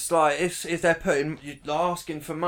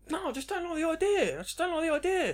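squeaky, much higher protest; high-pitched protest; 14 semitones higher, faster than usual. Real, not acted. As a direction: protest up high, repeating yourself. Pressed about disliking contactless.

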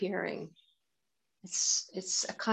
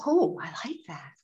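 whispered; 2 semitones lower, faster than usual. Acted. Inner voice liking something.

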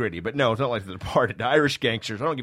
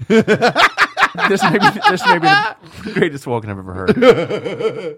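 slow, loud mock-reverent praise, laughing; sarcastic awe; 8 semitones higher, 8 dB louder, slower than usual. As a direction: drag it out in fake awe.

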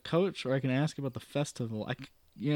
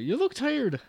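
high Irish lilt on look; 11 semitones higher, 5 dB louder, slower than usual. Acted. Landlady retaking you look tired, high Irish. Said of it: "oh, sorry".

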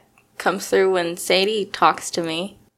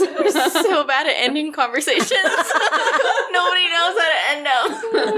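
laughing; 7 semitones higher than usual. Real, not acted. Laughing through the line.

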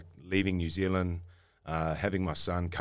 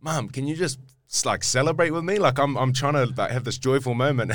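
high, louder, begging mum to celebrate; pleading excitement; 7 semitones higher, 7 dB louder than usual. Retold or imitated. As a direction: plead with mum to share the joy. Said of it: "I was literally like".

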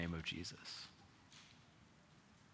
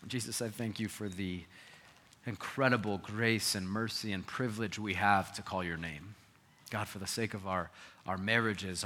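earnest, measured prayer voice; closing prayer; 5 dB louder than usual. Acted. Pastor praying over the congregation, earnest and measured. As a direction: lead the church in prayer.

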